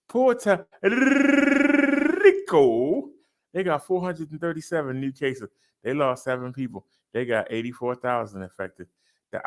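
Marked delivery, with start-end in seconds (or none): 0.1-3.1 high, loud, drawn-out "Puerto ... Rico" with a rise on "Rico"; big announcer call. Shown as a demonstration. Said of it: "we can do better than that".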